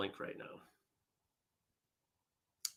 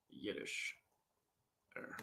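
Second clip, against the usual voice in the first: ordinary talk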